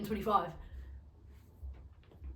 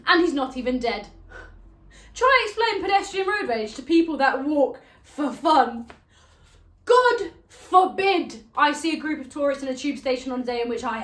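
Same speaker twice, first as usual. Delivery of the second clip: loud, raised-pitch tirade; exasperated rant; 4 semitones higher, 9 dB louder than usual. Acted. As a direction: rant it out at full volume. Ranting about pedestrians and tourists.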